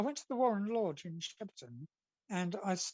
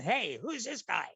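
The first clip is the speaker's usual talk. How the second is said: irritated queuers, growing higher and louder; annoyed crowd; 7 semitones higher, 4 dB louder than usual. Retold or imitated. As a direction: build from grumbling to a high indignant complaint. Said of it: "And they were going".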